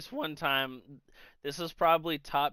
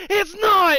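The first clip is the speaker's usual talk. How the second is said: very high, loud outburst; raging; 14 semitones higher, 11 dB louder than usual. Retold or imitated. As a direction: snap back in an imitated furious yell. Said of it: "he's fucking raging".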